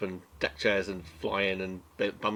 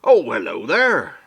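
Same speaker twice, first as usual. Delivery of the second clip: put-on loud, slow greeting as an old sea dog; old sailor dwarf voice; 8 dB louder, slower than usual. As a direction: call out hello like a hearty old sailor.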